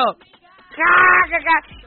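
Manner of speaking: loud, high imitated belly laugh; imitating a husband cracking up; mock guffaw. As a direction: burst out with a hammed-up "ha". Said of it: "el esposo de mi amiga, y así como".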